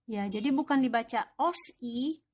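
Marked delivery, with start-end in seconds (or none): 1.4-2.2 "os i" with an s and a break before "i"; wrong split. Shown as a demonstration. Teacher shows students the mistaken "os i", split in two. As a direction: read "os i" as two pieces. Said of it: "bukan, salah ya".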